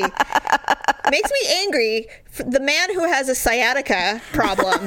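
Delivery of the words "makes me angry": "Makes me angry" drawn out slowly, every word leaned on; slow mock-angry repeat. Shown as a demonstration. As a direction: stretch out "Makes me angry" in a hammed-up grumble.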